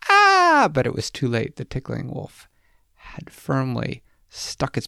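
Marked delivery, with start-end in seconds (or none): 0.1-0.7 very high, loud goblin shriek; startled shriek. Acted. Goblin grabbed by the wolf, high startled shriek. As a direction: shriek in sudden surprise. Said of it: "And the goblin went".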